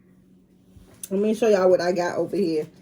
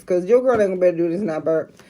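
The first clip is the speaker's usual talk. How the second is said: normal talking voice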